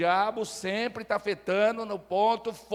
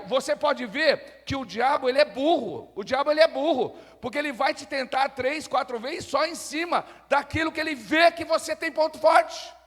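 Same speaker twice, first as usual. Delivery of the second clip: raised, emphatic pulpit voice building to a loud finish; fervent preaching; 5 semitones higher, 6 dB louder than usual. Real, not acted. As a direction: hammer it home to the congregation. Preaches at full force.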